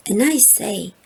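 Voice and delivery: plain reading voice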